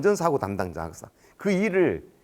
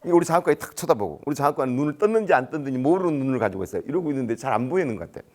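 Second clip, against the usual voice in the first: ordinary talk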